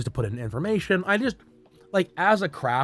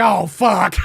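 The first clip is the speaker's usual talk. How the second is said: loud laughing oh fuck; laughing curse; 4 semitones higher, 5 dB louder than usual. Real, not acted. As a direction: blurt it out through a laugh. Caught out, laughing.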